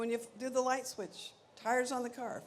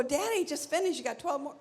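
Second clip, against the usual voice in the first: put-on pleading kid; 7 semitones higher, 5 dB louder than usual. Child pleading with dad.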